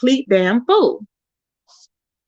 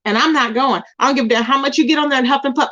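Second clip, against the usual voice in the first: normal talking voice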